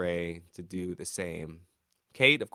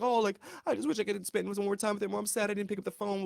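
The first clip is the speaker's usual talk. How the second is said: higher, faster, hammed-up grieving relative; mock mourner; 10 semitones higher, faster than usual. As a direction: play a fake-sad mourner, overdone. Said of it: "I was like".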